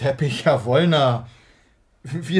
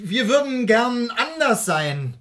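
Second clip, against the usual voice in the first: ordinary talk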